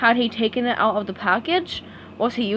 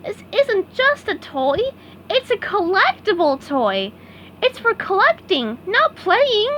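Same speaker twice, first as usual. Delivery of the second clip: SpongeBob voice; 10 semitones higher than usual. A narrator's reading. SpongeBob explaining collectibles.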